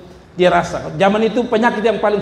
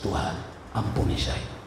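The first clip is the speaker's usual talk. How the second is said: very soft, low broken plea for forgiveness; tearful plea; 9 semitones lower, 11 dB quieter than usual. Acted. Weeping seller begging God for forgiveness, soft tearful plea. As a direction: whisper it through tears, begging. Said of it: "dia menangis".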